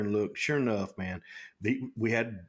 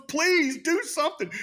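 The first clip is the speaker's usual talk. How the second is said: much higher, louder mock begging; pleading shout; 5 dB louder than usual. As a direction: beg him loudly to act. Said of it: "He goes".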